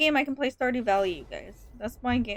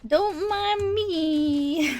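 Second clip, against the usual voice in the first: drawn-out; 5 semitones higher, much slower than usual. Pretend. Teasing drawn-out sing-song.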